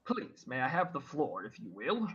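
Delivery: lightly voiced fussy crow, polite and prim; a hint of a prim, pompous bird; talking crow. Crow interrupting the party, lightly voiced prim bird.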